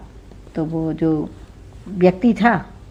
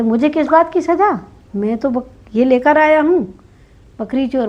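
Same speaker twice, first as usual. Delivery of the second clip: high, loud disbelieving protest; can't believe he's the one punished; shocked protest; 5 semitones higher, 4 dB louder than usual. Acted. Accuser protesting to the king, high and shocked.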